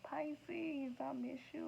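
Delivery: the card figure missing Pisces, high mock pining; lovesick card figure. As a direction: sigh it out like a lonely lover. Voicing the card figure again.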